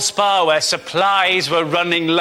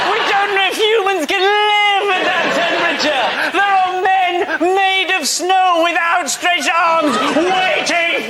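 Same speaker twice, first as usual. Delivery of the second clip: mock alarmist newsreader; 11 semitones higher, much slower than usual. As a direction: shout the warning like doomsday. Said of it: "the B B C were going".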